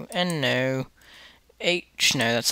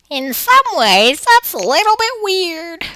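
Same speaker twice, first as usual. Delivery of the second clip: much higher, slowed, in a squeaky put-on voice; silly high voice; 13 semitones higher, 6 dB louder than usual. Vlogger repeating his phrase to viewers, in a squeaky put-on voice.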